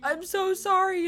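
high, drawn-out tearful apology; mock crying. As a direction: whine it like a crying child. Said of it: "sees a kid come in crying".

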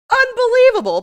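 high, loud, outraged cry; indignant outburst. Retold or imitated. Grieving son scolds the detectives, loud and indignant. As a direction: blurt it out in disbelief. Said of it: "And Ben's like".

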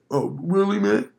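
stoned dude, voice rising; high stoner. Retold or imitated. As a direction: imitate a pleasantly surprised stoner. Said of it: "Fool was probably high as fuck".

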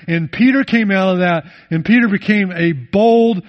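loud, driving preacher cadence; loud pulpit preaching on Peter's restoration; full-force preaching. Acted. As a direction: build and hammer it home to the congregation.

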